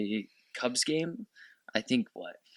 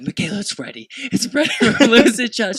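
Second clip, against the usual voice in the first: high, loud excited outburst; excited recognition; 10 semitones higher, 9 dB louder than usual. Retold or imitated. Telling Michaela she has spotted Freddie, excited. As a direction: blurt it out, thrilled, breaking into a laugh.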